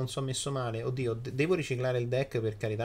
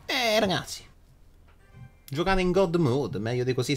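high, loud triumphant boast; excited; 6 semitones higher, 4 dB louder than usual. Real, not acted. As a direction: crow about the win.

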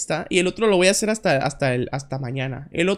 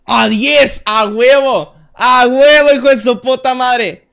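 loud, raised cheer of victory; triumphant shout; 4 semitones higher, 9 dB louder, slower than usual. Real, not acted. Player celebrates getting the points, triumphant shout.

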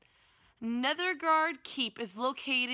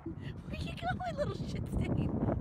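talking to a dog; slower than usual. Real, not acted.

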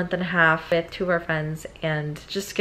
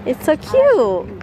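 gushing; 7 semitones higher, 3 dB louder, much slower than usual. Real, not acted. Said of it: "I feel like I'm in a movie".

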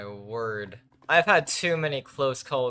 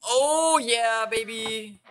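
excited cheer; 12 semitones higher, 7 dB louder, much slower than usual. Real, not acted. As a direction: crow with delight, stretched slow. Finds a prize.

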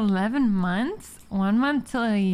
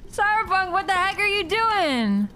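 shocked outburst; 8 semitones higher than usual. Real, not acted. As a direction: yell it in shock. Streamer shocked at game scene.

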